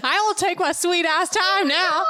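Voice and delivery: high-pitched, playful defiance; mock defiance. Reader defying co-host's call to hurry, mock-defiant. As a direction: push the voice way up in mock indignation. Reader teasingly defies being hurried.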